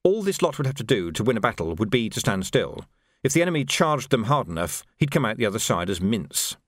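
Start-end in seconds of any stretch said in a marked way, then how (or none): none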